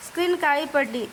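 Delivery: ordinary delivery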